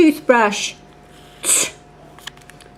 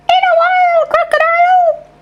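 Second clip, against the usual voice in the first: high squeaky puppet voice, higher; 13 semitones higher than usual. Pretend. Goldie the puppet says goodbye to the teacher, high voice. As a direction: chirp the goodbye like a little puppet.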